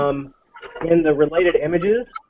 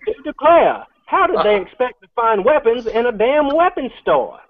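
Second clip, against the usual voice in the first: mock Southern accent; 6 semitones higher than usual. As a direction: play a scandalized Southern gentleman. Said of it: "This is the Confederacy".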